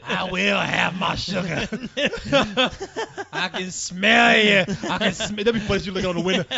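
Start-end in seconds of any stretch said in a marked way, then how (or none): 0.0-5.4 creepy sugar lady. Pretend.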